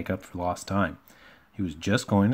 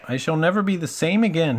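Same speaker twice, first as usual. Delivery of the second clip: high, louder woeful lament; sad; 9 semitones higher, 5 dB louder than usual. A narrator's reading. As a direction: bemoan it in a storybook wail. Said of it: "he thought sadly".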